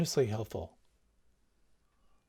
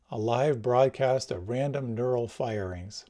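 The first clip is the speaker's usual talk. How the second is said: ordinary talk, nothing marked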